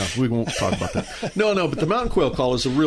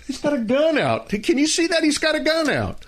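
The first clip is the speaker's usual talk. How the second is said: much higher, hammed-up panic; mock alarm. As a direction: pretend to be scared of a gun. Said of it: "Oh, no, I'm kidding".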